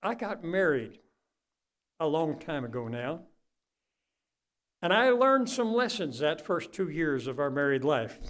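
usual speaking voice

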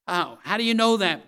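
high, loud mock questioning; imitated objector calling out; doubter's loud challenge. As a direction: demand proof like a loud skeptic.

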